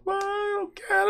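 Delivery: put-on high, pleading child voice; daughter begging. Imitating his daughter begging to get off the ride. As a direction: squeak it out like a scared little girl.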